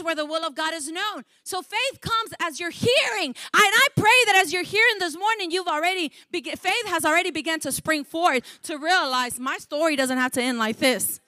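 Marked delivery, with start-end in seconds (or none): none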